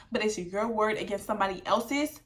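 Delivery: usual speaking voice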